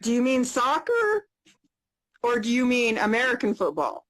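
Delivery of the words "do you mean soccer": ordinary talk, nothing marked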